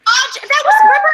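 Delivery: high excited squeal; surprised. Real, not acted. Surprised by fifty dollar donation.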